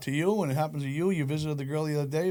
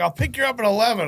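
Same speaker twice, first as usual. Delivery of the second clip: higher, louder mock friend voice; imitating friend; 8 semitones higher, 5 dB louder than usual. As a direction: chirp it like the cheerful buddy.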